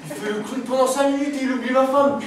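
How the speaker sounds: husband rages that his wife was forgotten; furious outburst. Real, not acted. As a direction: blow up at the forgotten question.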